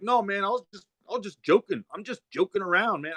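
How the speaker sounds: high, whiny I was just joking; mocking the backpedaling guy. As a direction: imitate the guy backing off, pitched high. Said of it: "he's like".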